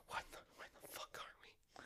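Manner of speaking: stunned whisper, barely voiced; whispered bewilderment. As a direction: whisper it as a baffled newcomer. Will's bewildered arrival in Tortuga.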